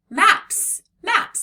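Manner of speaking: maps, maps, jumped high and loud; cheerful teacher modelling the word; bright excited reading. Shown as a demonstration. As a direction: sing out the plural for the class. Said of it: "There's more than one map".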